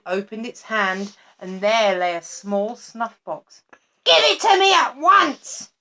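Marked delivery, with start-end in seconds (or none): 4.0-5.7 much higher and louder demand; snatch at it with your voice; greedy cry. A narrator's reading. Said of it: "cried the witch".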